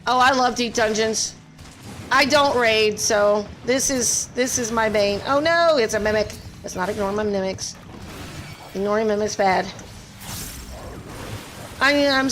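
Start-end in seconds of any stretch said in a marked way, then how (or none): none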